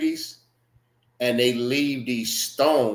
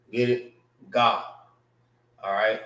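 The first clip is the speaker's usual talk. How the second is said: ordinary delivery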